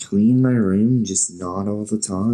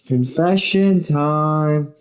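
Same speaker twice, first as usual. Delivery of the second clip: slow, high, drawn-out announcement; mock-grand segment intro; announcer voice; 7 semitones higher, much slower than usual. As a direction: draw it out like a game-show host.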